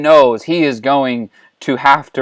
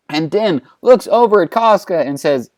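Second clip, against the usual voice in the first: ordinary delivery, nothing marked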